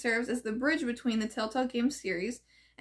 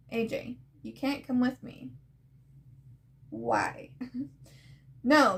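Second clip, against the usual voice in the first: acted comic reading. Reads comic dialogue, voicing characters.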